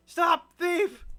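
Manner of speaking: loud, high shout. Pretend. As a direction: yell it after a thief. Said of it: "Just like".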